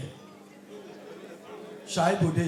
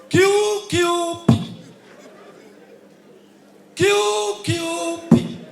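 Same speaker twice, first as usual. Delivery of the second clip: very slow, higher, louder party name; mock-grand results announcement; drawn-out announcement; 6 semitones higher, 6 dB louder, much slower than usual. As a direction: stretch out each letter like a results call. Mock announcing his uncle's party.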